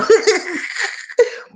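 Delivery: high-pitched giggling burst; real high cackling laugh; amused laugh. Real, not acted. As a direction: crack up at a silly nickname. Laughs at the Bobo nickname.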